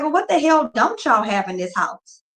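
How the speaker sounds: outraged what-the-hell, voice climbing; fed up and incredulous at the owner. Retold or imitated. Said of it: "I'm like".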